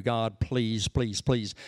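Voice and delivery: low, mild repeated plea; begging. Acted. Preacher voicing David's plea to God, mild begging. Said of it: "he's begging all the way through".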